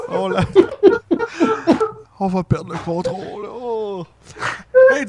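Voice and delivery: lightly voiced Santa fighting laughter; lilting questions to a child, barely composed; giggly Santa Claus. Santa struggles not to laugh.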